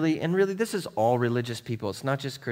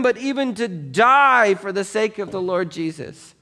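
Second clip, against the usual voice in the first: raised, ringing preacher's voice reading scripture; preaching at full force; 12 semitones higher, 10 dB louder than usual. Acted. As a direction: proclaim Paul's words to the congregation. Preacher reads Paul's words aloud.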